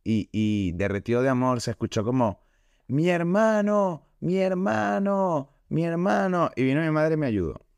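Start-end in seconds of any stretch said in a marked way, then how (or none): 2.9-6.5 high, slow wailing cry for his brother; desperate cry. Retold or imitated. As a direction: cry out in mock anguish, drawn out. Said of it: "Daniel empieza a gritar". (grito desesperado, agudo y lento)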